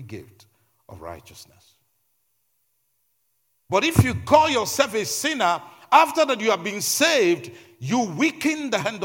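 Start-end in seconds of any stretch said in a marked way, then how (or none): 3.7-9.0 loud, high, driving sermon delivery; raised-voice preacher building with insistence; impassioned preaching. Real, not acted. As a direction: preach it at full force, punching each phrase. Preaches at full force.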